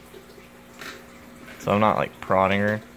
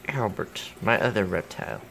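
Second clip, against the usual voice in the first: normal talking voice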